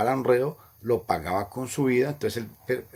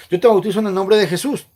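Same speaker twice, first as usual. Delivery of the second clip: higher, louder, fast recitation; baptismal formula voice; 8 semitones higher, 9 dB louder, faster than usual. Retold or imitated. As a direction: rattle off the officiant's words. (fórmula bautismal, rápida y más fuerte)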